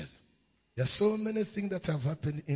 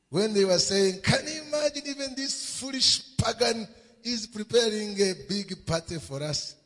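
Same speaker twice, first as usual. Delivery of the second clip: loud, high voicing of boastful brothers; impassioned preaching; 8 semitones higher, 4 dB louder than usual.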